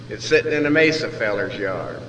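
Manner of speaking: plain reading voice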